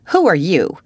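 loud, unceremonious challenge; brusque old lady's sharp question; blunt demand. A narrator's reading. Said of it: "demanded Miss Josephine Barry without ceremony".

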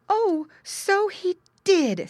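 high, loud, very slow; dismayed mother pig. A narrator's reading. Mother pig sees the hole, high dismay. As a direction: draw it out in high alarm.

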